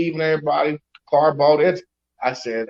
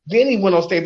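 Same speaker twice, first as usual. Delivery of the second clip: usual speaking voice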